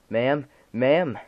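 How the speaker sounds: loud alarmed shouting. Acted. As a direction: yell into the phone. Officer shouts into phone.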